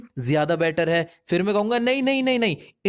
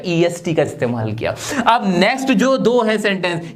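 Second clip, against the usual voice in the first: normal talking voice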